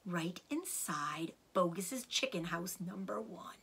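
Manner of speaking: soft conspiratorial whisper. Acted. Mister Fox whispers the secret to Badger. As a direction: hush it like a secret.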